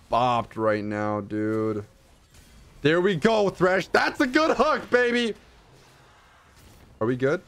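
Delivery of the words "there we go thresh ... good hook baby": excited. Real, not acted. Cheering a good hook.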